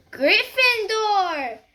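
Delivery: high, loud, slow shout; sorting hat shouting. Acted. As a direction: proclaim it to the whole hall. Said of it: "heard the hat shout".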